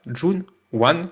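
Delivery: slow, careful try at a Korean name in a Korean accent; mock Korean accent on the director's name; self-mocking Korean accent. Shown as a demonstration. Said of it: "attention à mon accent".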